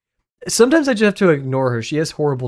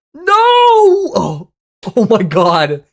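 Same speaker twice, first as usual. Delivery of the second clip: sudden high cry of shock, much louder; surprised shout; 6 semitones higher, 6 dB louder, much slower than usual. Real, not acted. Streamer yelling at a bad pick with a shocked cry. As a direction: yelp out in dismay.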